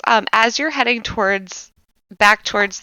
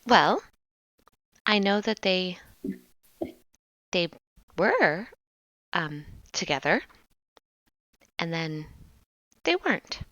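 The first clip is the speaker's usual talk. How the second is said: soft hesitant woman; 7 dB quieter than usual. Acted. GM plays Bitsy, hesitant answer.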